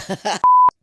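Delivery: shrieking burst of laughter; loud high laugh. Real, not acted. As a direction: squeal with laughter.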